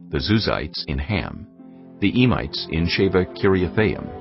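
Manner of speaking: ordinary talk, nothing marked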